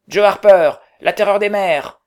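very loud, high pirate boast; loud pirate call. Acted. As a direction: bellow the title like a sea terror. Pirate boy announcing himself.

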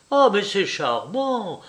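high, drawn-out exclamation; sudden exclamation. A narrator's reading. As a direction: cry out slowly and high. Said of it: "s'écria-t-elle tout à coup". (exclamation soudaine, aiguë et traînante)

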